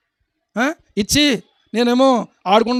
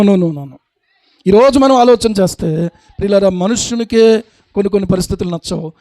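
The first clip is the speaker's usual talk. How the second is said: normal talking voice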